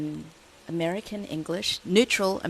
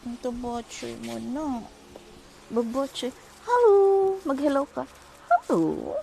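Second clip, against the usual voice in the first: high cooing pet talk; high sing-song voice for a pet; 8 semitones higher, 4 dB louder than usual. Real, not acted. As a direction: fuss over a chubby puppy. Cooing to chubby dog.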